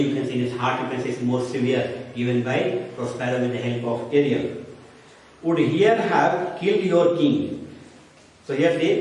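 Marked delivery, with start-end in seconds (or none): 5.4-7.7 higher, slow declaimed verse; dramatic recitation. Shown as a demonstration. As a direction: read it as a stage line. Teacher declaims Shakespeare quote.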